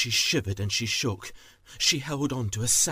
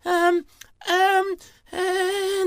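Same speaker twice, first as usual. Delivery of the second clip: very high, loud nervous squeak; stammering thief; 9 dB louder, much slower than usual. Acted. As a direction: stall and stammer in a tiny high voice. Thief stalls for an excuse.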